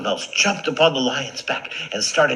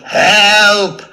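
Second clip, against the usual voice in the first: long high yells; trapped lion's desperate, hammed-up cries; calling for help; 14 semitones higher, 13 dB louder, much slower than usual. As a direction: holler at full force from a trap. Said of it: "He called for help".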